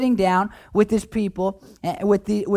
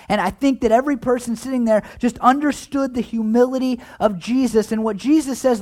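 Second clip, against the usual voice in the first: ordinary talk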